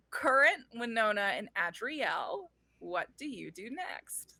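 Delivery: normal talking voice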